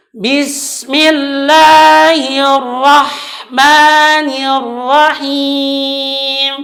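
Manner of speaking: slow melodic Quran recitation; correct recitation. Shown as a demonstration. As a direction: chant it slowly and clearly. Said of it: "bacaan yang benar". (bacaan tartil yang benar)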